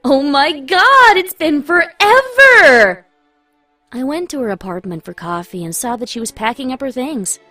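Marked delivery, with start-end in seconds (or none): 0.0-3.1 loud, high squeal of delight; overreacted greeting. A narrator's reading. Running into an old classmate, overreacting with loud excitement. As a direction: gush it out, stretching the words. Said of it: "but overreacted the way you do".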